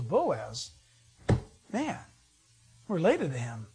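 a hushed, low gasp of recognition from the mother-in-law; amazed Naomi. Acted. Naomi hears Ruth met Boaz, low amazed hush. Naomi surprised at Ruth's news.

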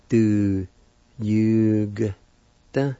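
Du, yu, g, da said sound by sound; syllable breakdown. Shown as a demonstration. Teacher breaking down the first part, sound by sound. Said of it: "pronounce the G sound with the Y U like this".

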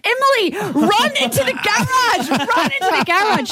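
high urgent call from a neighbour, only lightly voiced; high urgent calling, lightly acted. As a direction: hurry someone into the garage from a driveway. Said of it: "she's like".

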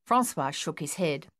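usual speaking voice

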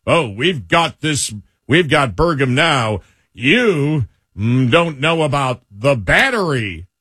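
slow, low mock-smug imitation. Host imitating politicians who ridicule the secretary, mocking. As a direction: draw it out low, mocking their opponents. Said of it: "And mocking as if".

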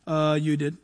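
low, soft, sheepish admission; small hesitant mock reply; sheepish Moses. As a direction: answer meekly, as if caught out. Moses caught out by God.

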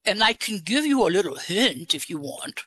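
put-on disguise the voice with an English accent. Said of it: "Where did you get your English accent?".

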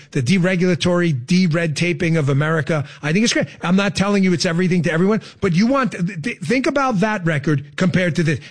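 usual speaking voice

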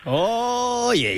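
long, high-pitched "oye"; shocked reaction. Real, not acted. Host hearing the brother's revelation, shocked. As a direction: draw it out high in real shock.

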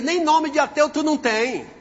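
high-pitched scoff; incredulous put-down, moderately loud; mocking retort. Retort to atheist, first take.

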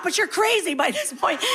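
usual speaking voice

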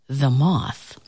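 ordinary delivery

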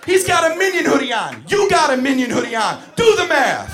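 high, loud mock-furious yelling; fantasy teacher rant. Teacher voices what he'd love to yell at the tattling kid, high and loud. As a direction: unload on the kid like a teacher who's lost it. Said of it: "That's what I wanna say".

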